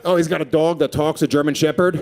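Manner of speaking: normal talking voice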